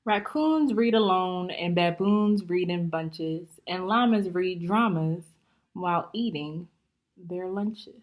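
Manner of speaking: lively, bouncy rhyming storybook reading; story read-aloud. Acted. Teacher reading a rhyming book to young students, lively. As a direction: read it out to the class, full of life.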